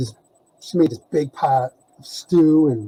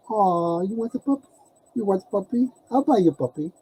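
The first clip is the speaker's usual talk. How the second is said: cooing reply; 5 semitones higher, 4 dB quieter than usual. Retold or imitated. Teller cooing back to her.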